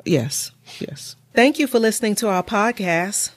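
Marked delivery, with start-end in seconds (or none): 0.0-1.2 soft whispered agreement; whispered yes. Real, not acted. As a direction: breathe the words in agreement. Quiet agreement.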